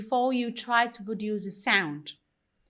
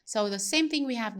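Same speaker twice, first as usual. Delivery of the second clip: ordinary talk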